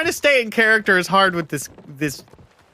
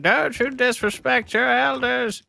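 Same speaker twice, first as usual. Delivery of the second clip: put-on old granny voice; 6 semitones higher than usual. Playing Granny Rex, captain.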